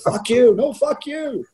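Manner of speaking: high-pitched mock yelling match; squeaky imitation of two men squaring off; mock quarrel. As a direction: trade hammed-up insults in a strained high voice. Retold quarrel between the two teammates.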